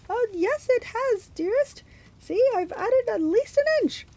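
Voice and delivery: very high falsetto fake bride; put-on high bridal voice, placating; Grover. A narrator's reading. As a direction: plead sweetly in falsetto. Grover keeps up falsetto bride disguise.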